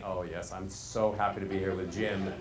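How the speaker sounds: low, soft monotone gladness; low pitch. Acted. Presenter happy to be with Jim, low monotone. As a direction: claim happiness on one low note. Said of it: "just on two pitches".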